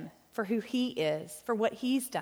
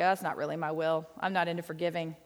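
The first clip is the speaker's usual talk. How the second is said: lightly voiced God, pitched lower; 5 semitones lower than usual. God turning down a prayer for forgiveness, in a lightly put-on God voice. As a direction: put on a slightly deeper God voice. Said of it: "That's my God voice".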